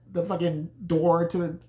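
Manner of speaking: ordinary talk, nothing marked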